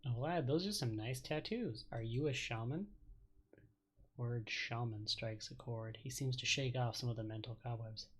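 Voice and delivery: ordinary talk, nothing marked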